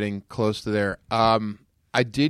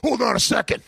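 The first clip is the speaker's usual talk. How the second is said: Hulk Hogan; 8 semitones higher, faster than usual. Retold or imitated. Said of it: "he's the Hulkster".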